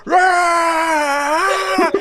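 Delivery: high yell building to a long scream; swept-up frenzy. Acted. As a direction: get carried away into a drawn-out roar. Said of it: "you're just taken over with this".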